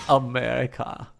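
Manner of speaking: put-on slow word in a German accent. Streamer reading Joshy Man's request, slow German accent. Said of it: "say it in a German accent".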